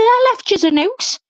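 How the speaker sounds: high-pitched imitation of mum; mum's retort. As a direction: answer as the put-out mother. Acting out mum's indignant answer.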